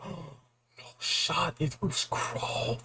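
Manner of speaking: hushed, breathless disbelief after a gasp; stunned half-whisper; awed whisper. Retold or imitated. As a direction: whisper it like the twist just hit you. Realizing the twist, gasps first.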